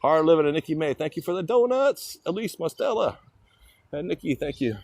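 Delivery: high, lifted thank-you; excited thanks. Real, not acted. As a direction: call out thanks happily. Thanking gifters on live.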